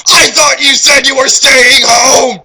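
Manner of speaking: slow loud high yell; hammed-up slow shouting; all caps shout. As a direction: bellow every word, all caps. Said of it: "So, it was in all caps".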